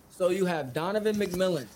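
normal talking voice

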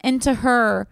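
ordinary talk

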